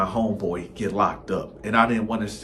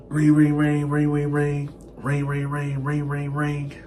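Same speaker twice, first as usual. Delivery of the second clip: sing-song mock ringtone, higher; phone ring imitation; 4 semitones higher than usual. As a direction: chant the rings like a phone.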